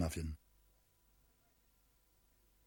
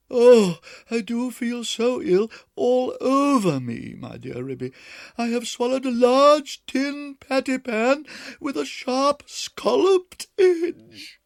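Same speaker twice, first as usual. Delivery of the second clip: much higher, louder, very slow wail; pitiful moaning; 11 dB louder, slower than usual. Acted. Duchess wailing to Ribby about the pan, moaning. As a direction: drag out the misery.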